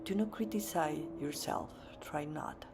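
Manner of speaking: soft, low meditation voice with long pauses; hushed and unhurried, leaving space to breathe; guided meditation. Real, not acted. Yoga teacher guiding the class into seated meditation, soft guided-meditation voice.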